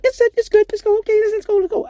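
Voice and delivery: high, loud eager nurse; excited nurse voice. Retold or imitated. Nurse back from hiding the speaker, high excited squeal.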